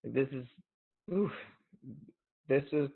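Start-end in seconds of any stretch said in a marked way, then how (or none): none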